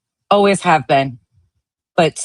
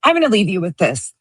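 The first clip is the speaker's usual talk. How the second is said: ordinary delivery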